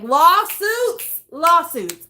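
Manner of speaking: loud, high mock-indignant cry; mock outrage. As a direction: demand it like an outraged diva. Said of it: "I'd be so mad".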